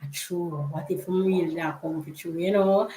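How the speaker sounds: a hushed, worried second thought; spooked hush. Retold or imitated. Girls fear rapture might come tonight, hushed uneasy. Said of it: "And then I was like".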